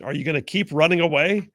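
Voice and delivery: ordinary talk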